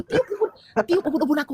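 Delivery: very high, urgent imitated command; retold panic. Panicking woman telling sister to open Alkitab, high and urgent. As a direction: re-enact panicking, voice jumping way up. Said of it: "kalau aku panik".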